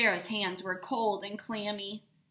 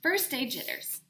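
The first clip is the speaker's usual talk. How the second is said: usual speaking voice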